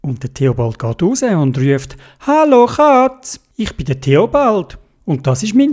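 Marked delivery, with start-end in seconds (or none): 2.2-5.7 calling out. Acted. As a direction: hail the cat from afar. Theobald calls the cat.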